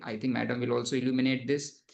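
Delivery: ordinary talk, nothing marked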